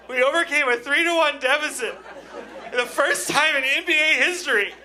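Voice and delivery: high-pitched impassioned yelling; defiant outburst. Acted. Husband defends his joy to angry wife, defiant high shouting.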